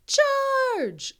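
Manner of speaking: very high "Charge!"; rally the herd; mock charging monster; dinosaur battle cry. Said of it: "dinosaurs yelling".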